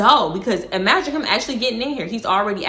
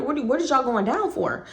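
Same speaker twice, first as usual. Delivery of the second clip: ordinary talk, nothing marked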